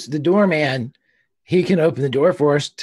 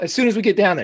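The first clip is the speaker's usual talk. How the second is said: ordinary talk, nothing marked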